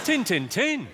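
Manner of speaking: high "Tin, tin, tin" in broad Yorkshire; Yorkshire dialect. Shown as a demonstration. Comedian repeats the phrase for laughs, "Tin, tin, tin" in Yorkshire dialect. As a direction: punch out "Tin, tin, tin" Yorkshire-style. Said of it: "My favourite Yorkshire phrase".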